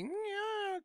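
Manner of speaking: put-on high, soft, doubtful girlfriend's yeah; a high unconvinced "Yeah"; mock Lou. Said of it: "if I was Lou, I'd probably be going like".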